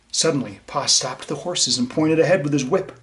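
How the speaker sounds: put-on lively read-aloud for young listeners; bright, gentle storyteller voice; story reading. A narrator's reading. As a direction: read it to the class like a picture book. Reads storybook to children.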